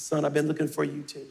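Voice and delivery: tender reply. Retold or imitated. Father embracing found son.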